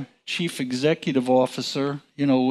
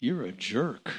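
much softer, under the breath; silent thought; 3 semitones lower, 9 dB quieter than usual. Retold or imitated. Watching a coworker mock the CEO, muttered quietly. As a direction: keep it to yourself. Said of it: "I'm sitting there going".

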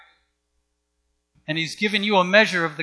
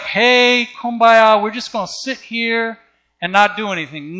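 mocking passivity; 5 semitones higher, 5 dB louder than usual. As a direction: mimic folks idly sitting around. Mocking passive believers who just sit.

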